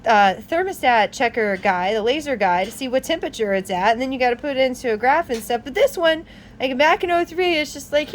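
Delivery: usual speaking voice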